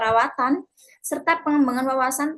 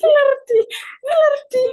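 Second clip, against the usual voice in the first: breaking character giggling; 13 semitones higher, 8 dB louder than usual. Real, not acted. Giggling over a stumbled word.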